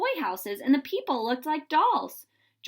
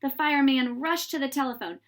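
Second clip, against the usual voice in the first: plain reading voice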